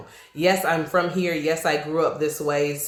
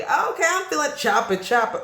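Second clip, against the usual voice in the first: mock listener; 9 semitones higher than usual. Mocks chopped music she hates.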